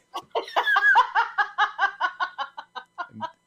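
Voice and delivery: high, prolonged cackling laugh; long high-pitched laughing fit; real helpless laughter. Real, not acted. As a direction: crack up and keep laughing. Listener cracks up at the story.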